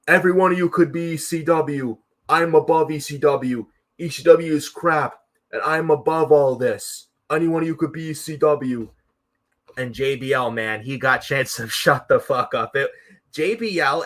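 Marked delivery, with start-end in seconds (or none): 0.1-8.9 high, loud, sneering put-on voice; imitating JBL. JBL sneering down at the ECW crowd, high mocking voice. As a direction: look down on the whole crowd, hammed up. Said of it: "And then J B L's like".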